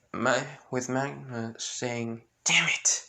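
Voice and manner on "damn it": higher, short exclamation; a touch of angry cursing; mild frustrated curse. Acted.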